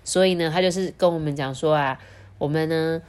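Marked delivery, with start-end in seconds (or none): none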